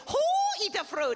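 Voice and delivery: teasing princess voice, lightly put on; hint of a foreign accent; mock Japanese accent. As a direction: tease like a tiny royal. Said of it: "she goes".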